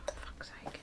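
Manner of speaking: under-breath whispered curse; whispered swear. Real, not acted. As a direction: hiss it to yourself, annoyed. Frustrated with her fringe.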